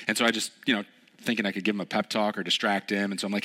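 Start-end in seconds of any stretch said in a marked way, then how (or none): none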